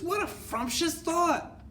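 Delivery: very high, slow exclamation; acted gleeful witch squeal; delighted witches' cry. A narrator's reading. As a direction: draw it out in high-pitched delight. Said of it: "cried the witches".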